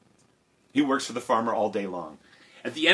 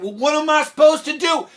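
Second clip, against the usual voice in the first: put-on loud, high boyish protest; helpless protest; 8 dB louder than usual. Jack asking mother for instructions, helpless. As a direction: throw the question back, baffled.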